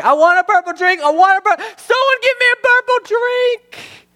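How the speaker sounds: hammed-up, very high screaming tantrum; crying and yelling. Husband demanding his wife's purple drink, crying and yelling. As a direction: wail and yell like an adult throwing a toddler's fit. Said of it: "started crying and yelling".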